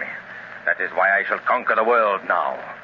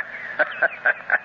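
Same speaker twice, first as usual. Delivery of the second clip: gloating evil laugh; 7 semitones higher than usual. Acted. Villain gloats over emperor dream.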